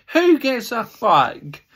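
angry retort. Real, not acted. Fan fires back at gloating rival fans, furious. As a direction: spit it back at the rivals, dragged out.

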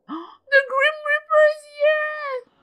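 gasp, then a loud high wail; high, drawn-out shocked scream; horrified cry. Real, not acted. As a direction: shriek in alarm, stretching the words. Reacting to the Grim Reaper arriving.

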